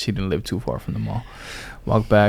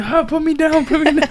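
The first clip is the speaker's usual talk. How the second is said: much higher, squeaky mock voice of a tiny teacher; little teacher protesting; 3 dB louder than usual. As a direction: play the small teacher being lifted up, squealing to be put down. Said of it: "She's like".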